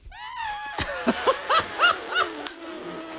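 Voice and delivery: high shouted trumpeting elephant cry. Acted. Elephant noise in story.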